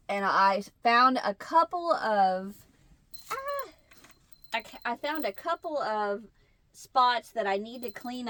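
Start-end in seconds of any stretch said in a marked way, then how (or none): none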